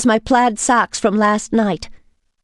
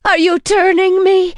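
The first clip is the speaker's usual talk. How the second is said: high, louder alarmed question; frightened cry; 12 semitones higher, 5 dB louder than usual. A narrator's reading. Woman asks vampire in alarm. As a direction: blurt it out in fear. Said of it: "I cried".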